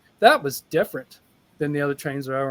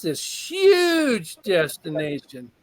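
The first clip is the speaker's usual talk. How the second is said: HUGE stretched out long and slow; stress on HUGE; much slower than usual. Real, not acted. Speaker telling how his destination changed, stress on HUGE. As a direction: draw out "huge" to show the size.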